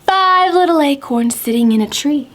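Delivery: sing-song rhyme with gasping wind effects; counting rhyme for kids. Acted. Teacher reading a counting rhyme to children, sing-song. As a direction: read it like a children's counting game.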